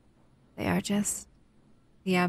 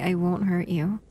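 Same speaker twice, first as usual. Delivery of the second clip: normal talking voice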